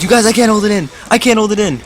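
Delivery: high-pitched mock yelling; panicked high-pitched whine, played for laughs; retold yell. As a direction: imitate a friend wailing in urgency. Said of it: "He starts yelling".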